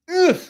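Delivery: high, loud grunt of energy; punchy "ugh" burst; energetic grunt. Shown as a demonstration. Acts out the energy of volume.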